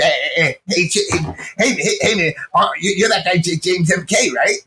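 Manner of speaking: stuttering impression, tripping over repeated words; Arturo Sanchez. Stutterer recognizing an old player, imitated stammer. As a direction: stammer on the first words, then push the question out. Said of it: "my Arturo impression is good".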